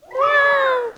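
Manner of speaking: high, loud falling meow; scared cat meow. Pretend. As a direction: squeal it like a frightened kitten. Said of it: "And then it's a scared cat".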